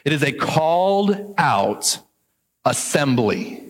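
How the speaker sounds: "called" stressed, whole phrase slowed; slow emphatic definition. Shown as a demonstration. Pastor giving the literal translation slowly. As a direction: lay out the definition word by word. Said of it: "here's the literal translation".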